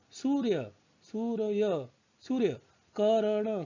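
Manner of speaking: सूर्य and कर्ण said with the र sound before the next consonant; demo words. Shown as a demonstration. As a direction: repeat सूर्य, कर्ण as a teacher's model. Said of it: "इसका उच्चारण जो है यो से पहले हो रहा है".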